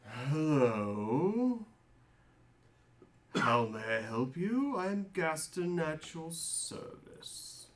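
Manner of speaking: put-on slow, formal old servant voice; lightly voiced old human butler; older butler. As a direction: greet guests like a courtly butler. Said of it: "an older human says".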